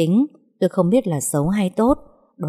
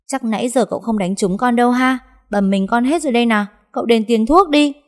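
cheeky complaint; 6 semitones higher, 3 dB louder than usual. A narrator's reading. Servant sassing angry young master.

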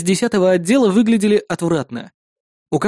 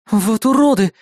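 higher-pitched indignant woman; 7 semitones higher, 3 dB louder than usual. A narrator's reading. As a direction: burst out in indignation. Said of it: "возмутилась она".

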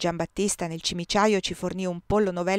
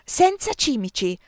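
a louder, sharp, spiteful jab; hissed jab; 4 semitones higher, 5 dB louder than usual. A narrator's reading. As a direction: get the last word in, needling. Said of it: "sibilò Maria".

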